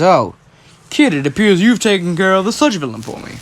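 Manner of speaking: a hint of a big hero addressing a kid; lightly voiced hero. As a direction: call out like an arriving superhero. All Might arrives, addresses the kid.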